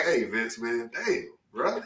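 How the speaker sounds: low, subdued voice, imitating a friend in need; mock downcast appeal. Man teasing his friend about crying to him, mock dejected. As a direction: act quietly crushed, reaching out to a buddy.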